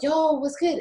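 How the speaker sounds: high-pitched mock street greeting; playful high slang greeting slipping out at an interview. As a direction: squeal out a casual slang hello. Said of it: "and be like".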